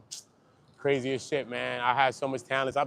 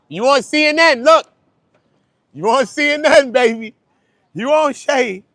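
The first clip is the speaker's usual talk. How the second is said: hype man; 9 semitones higher, 10 dB louder than usual. Real, not acted. Hyping up a woman on camera.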